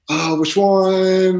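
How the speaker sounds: high, loud, drawn-out mock question; mock party reveller. Acting out the crazy bar scene after a win, high and drawn out. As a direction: stretch it out high and loud, playing it up. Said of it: "You're like".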